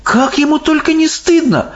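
put-on high, scandalised girl voice; indignant Sophie. A narrator's reading. Sophie hears of the giant's appetite, indignant. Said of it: "возмутилась Софи".

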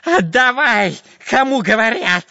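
high, loud, demanding yell; Dyrka yelling. A narrator's reading. Spy tugs at the chest, yelling demands. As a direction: insist shrilly on getting it. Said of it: "кричал длинноносый шпион Дырка".

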